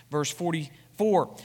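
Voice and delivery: ordinary talk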